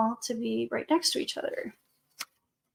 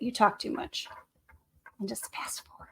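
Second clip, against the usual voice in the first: whispering; 3 semitones lower, 11 dB quieter than usual. Real, not acted. Aside to Missus Johnson.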